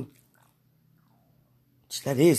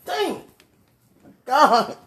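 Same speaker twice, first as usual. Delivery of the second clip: high excited exclaim; 8 semitones higher, 6 dB louder than usual. Real, not acted. Praying alone, squeals excitedly to God.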